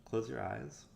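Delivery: ordinary talk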